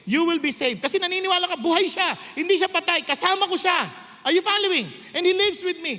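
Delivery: fervent sermon delivery; full-force preaching. Acted. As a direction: preach it out to the congregation.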